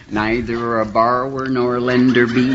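low, slow Hollywood-star drawl; Jack Nicholson. Acted. Imagining a film star as a great Hamlet, low slow drawl. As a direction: drawl the verse low and slowly. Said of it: "He'd be a great Hamlet, just like".

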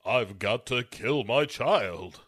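slow, manly actor impression; drawn-out movie-star voice, playing it manly; Gary Cooper. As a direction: put on a slow, manly leading-man voice. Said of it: "you've got Gary Cooper, like".

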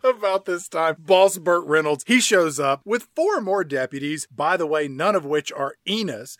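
ordinary talk, nothing marked

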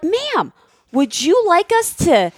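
lightly voiced salesman; polite salesman. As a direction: play the eager salesman offering help. Imitating the salesmen.

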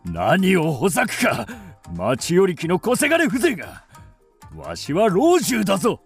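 put-on angry old lord. Exposed elder raging at a commoner.

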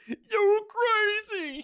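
very high, drawn-out yell; hammed-up shrieking shout. A narrator's reading. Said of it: "he shouted".

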